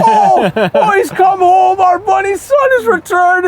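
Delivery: very high, loud mock-Irish cheering; excited Irish crowd. Irish locals hailing a returning son, hammed-up high mock-Irish shout. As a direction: shout it like overjoyed villagers, comically high. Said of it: "all the Irish people go".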